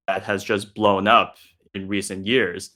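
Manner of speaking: ordinary talk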